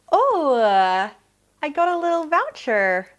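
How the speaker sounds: light up at the surprise; delighted. Real, not acted. Unboxing, delighted find.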